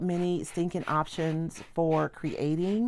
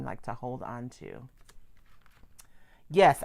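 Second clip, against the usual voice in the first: ordinary talk, nothing marked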